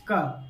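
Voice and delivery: क said lightly with little breath, low and soft; a soft, easy क with no puff of air; light unaspirated ka. Shown as a demonstration. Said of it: "बिल्कुल normal".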